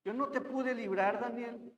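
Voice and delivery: softly mournful call; voz triste. Acted. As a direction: read the king's words with soft, understated sorrow. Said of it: "con voz triste y le dijo". (con voz triste, contenida)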